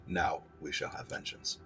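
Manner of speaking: whispered ghostly threat; whispered spirit voice. Acted. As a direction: breathe it like a voice in the mind. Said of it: "The voice echoes in your mind".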